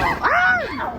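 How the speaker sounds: high loud playful ah; mock alarm. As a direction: yelp as if the storm hit. Mock fright at storm.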